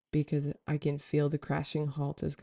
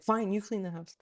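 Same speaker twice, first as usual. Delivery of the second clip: mock argument; 5 semitones higher than usual. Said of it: "arguing with spirit".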